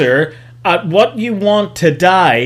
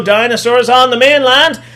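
ordinary delivery

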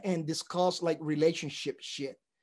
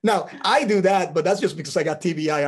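ordinary talk, nothing marked

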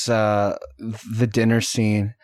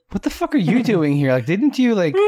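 higher, mock-shocked outburst; incredulous; 7 semitones higher than usual. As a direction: play the stunned dinner guests. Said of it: "everyone's like".

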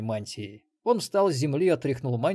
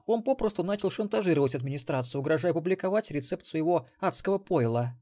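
lightly voiced woman, higher and grim; Мелисса, gloomy; 6 semitones higher than usual. A narrator's reading. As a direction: explain grimly in a raised female pitch. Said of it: "мрачно заметила Мелисса". (мрачно, повышенным женским голосом)

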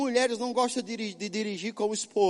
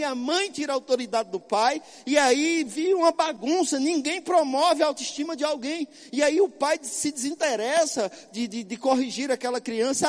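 ordinary talk, nothing marked

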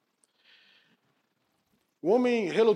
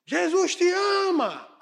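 drawn-out shout, much higher and louder; 12 semitones higher, 4 dB louder, slower than usual. Acted. Man obeying the Spirit at 3am, shouting slowly. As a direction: yell it slowly out to the empty street. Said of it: "Diga de novo".

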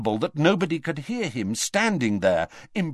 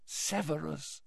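hushed, higher plea; quite soft; 4 semitones higher, 13 dB quieter than usual. A narrator's reading. Dumbledore pleads with Snape, quite softly. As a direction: breathe the name very softly. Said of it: "quite softly".

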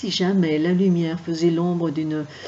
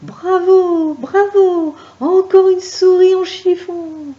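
high, loud delighted bravo; cheering; 12 semitones higher, 10 dB louder than usual. Acted. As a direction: cheer and clap with joy. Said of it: "Frisson lui applaudissait".

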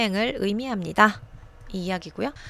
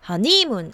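허니문 wrongly stressed on the final 문; misplaced stress; 4 dB louder than usual. Shown as a demonstration. Teacher showing another wrong stress, 허니문 stressed on 문. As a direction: punch the last syllable, 문, in 허니문. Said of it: "허니문도 아니에요".

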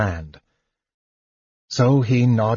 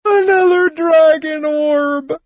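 much higher, louder, slow wail; kender wail; 6 dB louder than usual. A narrator's reading. Kender wails the terrible news to his friends, high and loud. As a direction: howl it out in tearful despair. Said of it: "Tas wailed".

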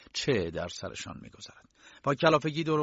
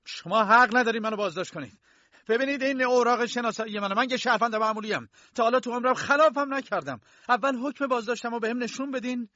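furious, much higher and louder protest; angry shout; 13 semitones higher, 5 dB louder than usual. Acted. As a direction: yell at the guards, outraged at the arrest. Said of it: "کی با عصبانیت داد زد". (فریاد خشمگین با صدای بلند و زیر)

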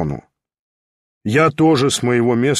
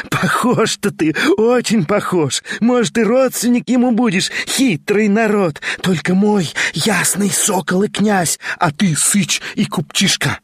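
higher, mocking madwoman taunting; triumphant madwoman; 8 semitones higher than usual. A narrator's reading. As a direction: gloat and sneer at the impostor. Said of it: "Она хохотала ему в лицо".

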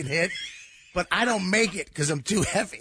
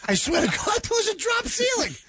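incredulous laughing outburst; 14 semitones higher than usual. Real, not acted. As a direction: laugh it out in disbelief.

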